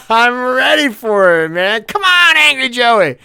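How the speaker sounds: high, loud come on; shouted eager challenge; gleeful taunt. Real, not acted. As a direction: egg him on, delighted. Bracing eagerly for bad service.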